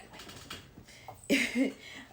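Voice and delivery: a real breathy laugh that breaks out; burst of laughter. Real, not acted. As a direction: laugh out loud, caught off guard. Laughing at cat interrupting.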